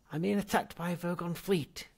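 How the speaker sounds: high, loud desperate cry; cried out. Acted. Zaphod crying out to his ghost ancestor, loud. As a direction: blurt the emergency. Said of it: "cried Zaphod".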